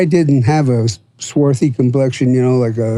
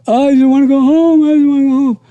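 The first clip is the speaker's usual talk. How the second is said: high whimpering plea, pitched way up; imitating crying farm boy; 11 semitones higher, faster than usual. Retelling a crying recruit begging to go home, high whimper. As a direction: mimic a homesick boy sobbing. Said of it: "just crying his eyes out".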